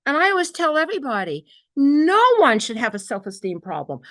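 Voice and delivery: ordinary talk, nothing marked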